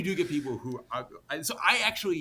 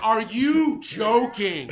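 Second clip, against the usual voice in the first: incredulous retort; 7 semitones higher, 7 dB louder, much slower than usual. Retold or imitated. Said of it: "and I'm like".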